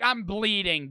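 ordinary talk

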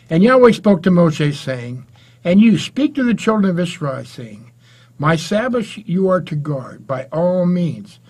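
loud, solemn Bible reading; scripture reading. Acted. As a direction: proclaim the verses to the congregation. Preacher reads scripture aloud.

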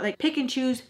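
ordinary delivery

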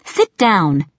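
mildly snapped order; curt command to an unruly child, understated. A narrator's reading. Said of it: "I snapped".

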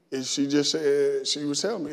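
ordinary talk

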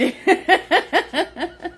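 a high, rising giggle. Real, not acted. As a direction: giggle up into a squeak. Laughing at chat comment.

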